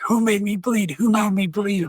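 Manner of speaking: high-pitched mock outrage; retold raging. Drunk man sees blood on his hand, imitated raging. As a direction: act out the drunk man's indignant rage. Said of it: "he got raging mad".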